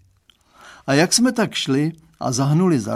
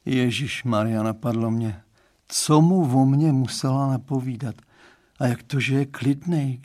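usual speaking voice

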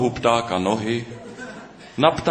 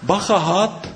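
much higher, louder startled cry; high panicky call; alarmed warning; 10 semitones higher, 4 dB louder than usual. A narrator's reading. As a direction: blurt out a sudden alarm. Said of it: "řekl pták Noha".

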